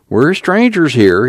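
high, drawn-out whine; servile whine. A narrator's reading. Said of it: "voice took on a servile whine".

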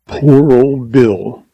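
very slow, grieving sigh over Bill; mournful. A narrator's reading. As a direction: mourn him, drawing out every word. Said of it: "he exclaimed mournfully".

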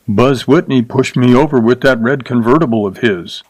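usual speaking voice

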